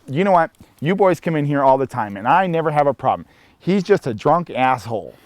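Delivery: high, loud emphatic explanation; bartender reassuring loudly. Retold or imitated. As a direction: back the regulars up with some heat.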